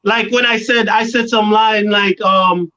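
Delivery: ordinary talk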